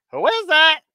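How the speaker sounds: high squawky parrot asking who's there; a parrot calling through the door. Retold or imitated. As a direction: chirp it out like a talking parrot. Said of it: "and the parrot says".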